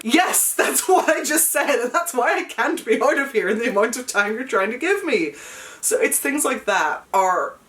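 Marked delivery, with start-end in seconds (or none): 0.0-5.3 exasperated retort. Retold or imitated. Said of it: "And I'm like".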